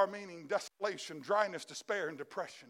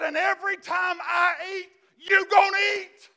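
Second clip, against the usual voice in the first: climactic shout; 9 semitones higher, 10 dB louder than usual. Acted. Sermon climax, king's promise.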